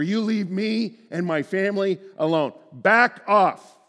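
firm shouted command; forceful rebuke. Pretend. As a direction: drive the enemy away. Rebuking evil, acted quote.

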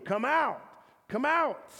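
high, rising summons; calling out. Acted. The voice that called Lazarus calling to you, commanding. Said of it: "the same voice that, that called Lazarus".

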